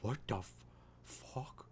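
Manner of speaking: soft, halting, stunned dog's voice; half-whispered disbelief as the dog; imagine the dog mouthing it in shock; voicing the dog. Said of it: "The dog is like".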